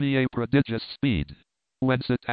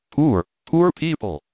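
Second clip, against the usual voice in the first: mildly distressed, a little louder on "poor"; distressed cry; 3 semitones higher, 5 dB louder than usual. A narrator's reading. Missus Challenger to her husband after the crash, mildly distressed. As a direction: pity the victims, voice lifted slightly. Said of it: "clinging with a whimper".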